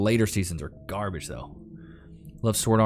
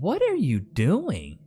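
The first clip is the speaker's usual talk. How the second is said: higher, drawn-out disbelief; mock bewildered question; 7 semitones higher, much slower than usual. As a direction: act baffled, stretching the question out. Acted reaction to anime noises.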